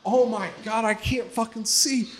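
high-pitched mock panic; panicked. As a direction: re-enact realizing you've gone blind. Acts out losing his sight.

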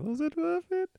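very high, soft falsetto dog; hushed cartoon-dog squeak; talking dog voice. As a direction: ask it in a small, squeaky dog voice. Repeats the imagined dog's question.

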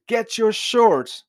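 David ordering his men to arm, furious shout; furious command. Retold or imitated. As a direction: bark it at your men in rage. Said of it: "The first thing he says is".

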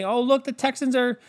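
mock gushing anchor. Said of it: "And they showed up Sunday".

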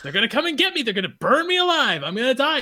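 put-on high, frantic child's wail, half laughing; a kid spiralling about greenhouse gases; panicked kid. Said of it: "and I was like".

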